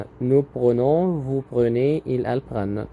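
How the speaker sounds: nous prenons drawn out very slowly, each syllable clear; teacher to class, stretching out nous prenons slowly; slow teaching model. Shown as a demonstration. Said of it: "هنا في دبل ان".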